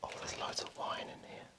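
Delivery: hushed whisper, sneaking around; breathy secret whisper. Real, not acted.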